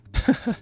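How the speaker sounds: quick high chuckle; small, high-pitched giggle; short laugh. Real, not acted. Reader amused by the bare Teemo entry, quick high laugh.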